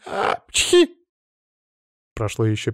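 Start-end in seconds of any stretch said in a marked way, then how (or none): 0.1-0.9 high acted "achoo"; acted sneeze. A narrator's reading. Маша sneezes at the driver's little animal, acted sneeze.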